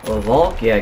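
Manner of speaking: normal talking voice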